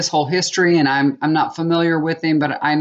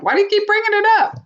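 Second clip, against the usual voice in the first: much higher, fast, fed-up question; exasperated snap; faster than usual. Retold or imitated. Wife snapping at husband who keeps mentioning the party, exasperated. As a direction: cut him off in irritation.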